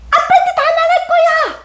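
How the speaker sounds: very high bratty threat; tattling child. Acted. Child threatening mom with dad, high tattletale whine. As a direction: threaten to tell like an indignant little kid. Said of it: "아이랑 싸우는 엄마도 봤어".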